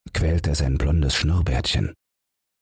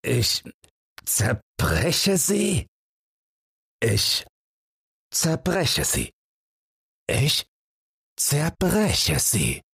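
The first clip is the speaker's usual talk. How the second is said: "zerbreche sie" much higher and very slow, with heavy stress; emphatic repetition; 10 semitones higher, much slower than usual. Acted. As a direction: hammer "zerbreche" each time, slow and high. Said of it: "wiederholt er mit Betonung". (Holzer wiederholt die Worte betont, hoch und langsam)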